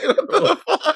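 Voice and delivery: very high, laughing what the fuck; laughing disbelief. Retold or imitated. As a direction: squeal it through laughter. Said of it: "was like".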